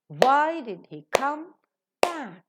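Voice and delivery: "Why", "come", "back" drawn out with a gap before "back"; stress on why, come, back. Shown as a demonstration. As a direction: beat out "Why", "come", "back", reduce "did he". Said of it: "is accented".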